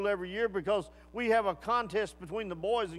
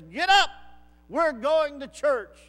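loud barked wake-up call, higher; retold yell; 6 semitones higher, 8 dB louder than usual. Big man yelling the soldier awake, loud. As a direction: bellow it like a drill sergeant. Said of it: "yelled at me and says".

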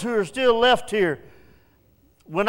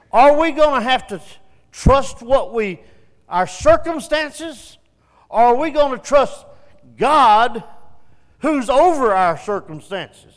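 a preacher rising to full force on the trust question; preaching build; 4 semitones higher, 6 dB louder than usual. Real, not acted. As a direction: drive the question home to the pews. Preaching climax to congregation.

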